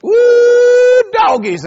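high loud whooping "Woo, doggies"; excited brother-in-law. Retold or imitated. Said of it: "he gets excited. He goes".

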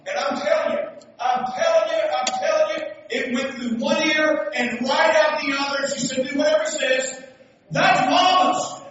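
loud, high, insistent preacher delivery; full-force preaching. Acted. Preacher telling how mama brushed it off, forceful preaching. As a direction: drive it home, building in pitch and volume.

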